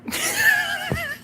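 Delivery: shrill high-pitched cackle; sudden high squeal of laughter. Real, not acted. Laughing at her own line.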